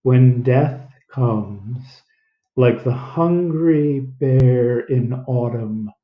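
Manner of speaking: slow, over-enunciated read; slow, exaggerated reading. Shown as a demonstration. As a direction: stretch every word out. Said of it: "When you read in a really exaggerated way".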